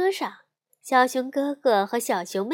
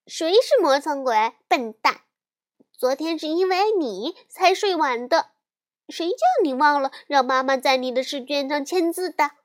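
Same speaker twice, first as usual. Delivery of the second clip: high, raised voice of an indignant little sister; yelling back; 4 semitones higher, 4 dB louder than usual. A narrator's reading. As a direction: fire back at your brother, pitched up and quarrelsome. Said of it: "小熊妹妹听了也吼了起来". (小妹妹提高嗓门生气地吼回去)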